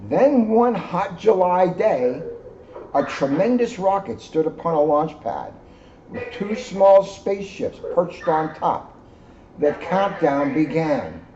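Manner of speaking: gentle read-aloud storytelling; story narration. A narrator's reading. Reading the moon story to the children, storyteller voice. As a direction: tell it like a bedtime story to kids. Reads picture book to children.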